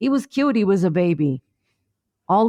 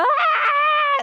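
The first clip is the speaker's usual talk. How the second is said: very high shrieked scream; mock scream at the top. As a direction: yelp at the drop of the slide. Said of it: "then you're".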